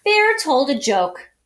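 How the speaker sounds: ordinary delivery, nothing marked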